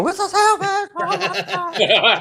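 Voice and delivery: very high-pitched retold squeal; little-girl scream. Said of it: "screaming like a little girl".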